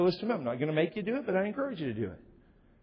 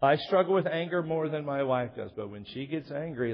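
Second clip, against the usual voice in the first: ordinary delivery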